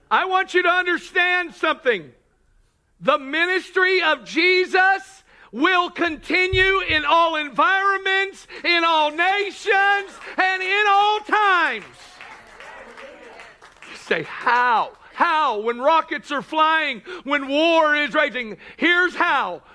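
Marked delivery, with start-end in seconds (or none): 0.1-11.9 full-force preaching. Acted. Preacher proclaiming Jesus' ministry to the congregation, full-force shout. 14.2-19.6 high, loud rhetorical questions; full-force preaching. Acted. Preacher answering the congregation's how, forceful high proclamation.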